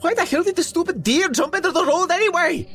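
fast, loud, very high screech; screeching, high-pitched tantrum; hysterical outburst. A narrator's reading. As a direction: rant in frantic outrage. Mo raging, pounding the steering wheel.

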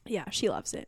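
usual speaking voice

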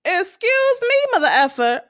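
high-pitched mock indignation ending in a laugh; Tasha. Tasha reacting to Ghost's threat, indignant. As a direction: play her affronted, amused as you retell it. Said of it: "So Tasha's like".

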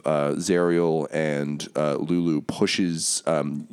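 usual speaking voice

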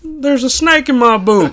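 high, loud cowboy toy voice; squeaky cowboy doll catchphrase; pull string toy. Acted. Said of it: "That's your Woody".

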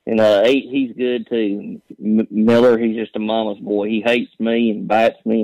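usual speaking voice

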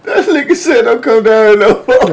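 high-pitched, laughing repeat; laughing disbelief. Real, not acted. Host repeating kid's threat to friend, laughing disbelief.

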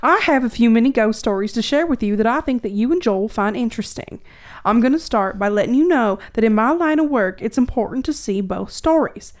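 put-on Southern accent for reading aloud; Tennessee accent. Host reading Nashville listener's letter, imitated Tennessee accent. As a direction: lay on a Tennessee twang. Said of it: "I just saw Tennessee".

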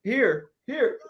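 hammed-up coach showing two hands; Coach Cal impression. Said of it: "And he would be like".

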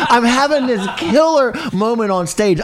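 laughing and loud, way up high; can't hold back the laugh. Real, not acted. Telling friends about his killer moment, laughing hard. Gleeful retelling of stage triumph.

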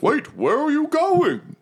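very high, pleading call; alarmed Mahadi. Acted. Tiger devil calls after departing party, high alarmed voice. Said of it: "Mahadi's like".

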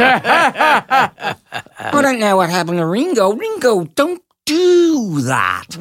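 high [name] impression, slow ending; lilting Liverpool Beatle voice; Liverpool accent. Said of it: "he had this big voice".

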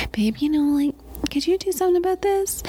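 high, sweet, gentle request to babe; sweet asking voice. Retold or imitated. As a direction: coax him nicely, not nagging. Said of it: "I'm trying to be like".

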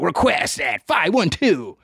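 slow, smooth radio DJ read; mock a cheesy radio host. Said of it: "sounding like a fucking radio D J".

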